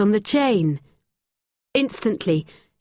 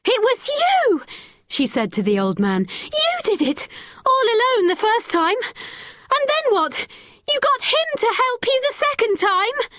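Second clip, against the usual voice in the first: much higher, accusing cry; girl shouting at the old man. A narrator's reading. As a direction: hurl the accusation at him. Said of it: "Becky started shouting".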